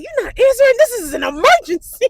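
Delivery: imitating a mother, high and loud; hammed-up emergency panic; mock urgent alarm. Mother on the phone demanding an answer, urgent alarm.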